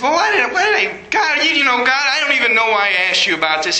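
giddy disbelief, laughing it off; retold giddy laugh at his own worry; laughing relief. Retold relief after getting books.

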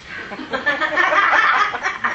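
a high, happy burst of laughter; real laugh. Real, not acted. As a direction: laugh at his own excitement. Laughs while retelling.

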